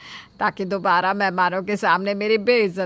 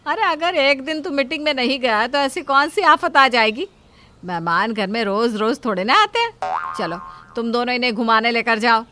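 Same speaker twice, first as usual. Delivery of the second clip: loud, higher scolding; 3 semitones higher, 5 dB louder than usual. Acted. Mother scolding her son over his meeting, loudly. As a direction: brush off his excuse, raised voice.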